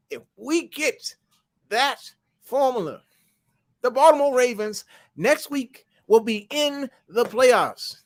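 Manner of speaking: high, slow, drawn-out declaration; excited pronouncement. Real, not acted. Telling Hassan the Ravens' playoff path, high and slow. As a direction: savor every word of the promise. Dramatic payoff of playoff formula.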